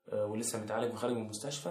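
usual speaking voice

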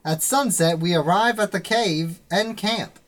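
put-on old-time serial announcer recap; Legend of Korra announcer. Game master recaps the adventure to players, announcer voice. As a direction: punch out each phrase like an episode recap. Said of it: "I'll be the announcer from The Legend of Korra".

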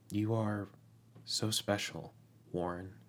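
soft, intimate mutter. Acted. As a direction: murmur it close to his cheek. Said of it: "Thomas mutters".

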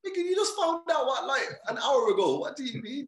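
loud, very high-pitched disbelief; incredulous protest. Retold or imitated. Son protests how quickly mum sorted the move, incredulous. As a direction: retell an outburst of comic disbelief.

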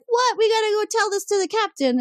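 high, sudden alarm, then urgent; startled outburst. Retold or imitated. Officers hear Wesley's news and react with high alarm. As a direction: leap up in pitch with alarm. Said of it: "And they're like".

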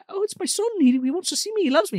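high-pitched imitated pleading; mock defensive father. Father insists his son loves him, high mock pleading.

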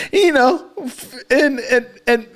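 high, stammering through real laughter; real giggles choking the words; breaking up laughing. Real, not acted. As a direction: fight laughter to get words out. Cracking up telling story.

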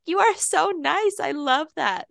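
high, drawn-out gushing; sweetly touched, retold; gushing appreciation. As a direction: coo it slowly, way up high. Gushing thanks to a friend.

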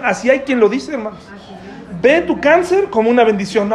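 usual speaking voice

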